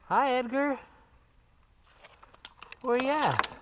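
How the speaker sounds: high sing-song call to a cat. Real, not acted. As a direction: call up sweetly, pitched high. Calling up to cat in tree.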